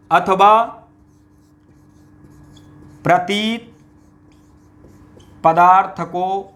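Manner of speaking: each word said alone with long pauses; slow dictation so students can write it down; dictating word by word. Shown as a demonstration. Teacher dictating a definition to students, slowly word by word. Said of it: "तो ये दूसरा ये रख लो".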